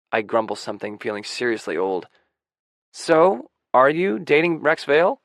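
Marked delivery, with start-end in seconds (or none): 2.9-5.2 louder, higher, eager prying; pressing question. A narrator's reading. Young friend presses narrator about the boyfriend, insistent. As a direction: push the question, loud and high.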